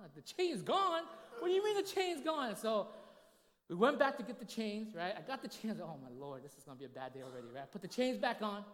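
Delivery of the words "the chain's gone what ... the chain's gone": high, loud, startled cry of disbelief; husband hearing from wife the chain is gone, shocked. Retold or imitated. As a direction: blurt it out high in sudden alarm. Learns chain flew off.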